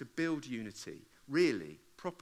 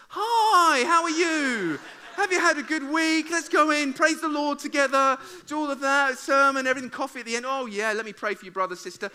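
fake cheery churchgoer; 11 semitones higher, 13 dB louder than usual. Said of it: "You see somebody else and it's".

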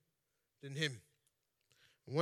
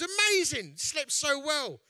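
mock elated; 10 semitones higher, 7 dB louder, much slower than usual. [name] buzzing after his sleep.

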